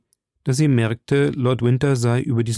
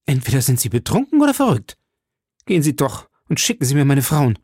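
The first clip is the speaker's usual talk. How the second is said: fast, higher-pitched affronted outburst; indignant; 4 semitones higher, 3 dB louder, faster than usual. A narrator's reading. Milady orders her brother-in-law out, indignantly. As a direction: dismiss him briskly and angrily.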